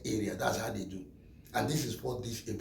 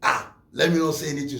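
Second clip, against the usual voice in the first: usual speaking voice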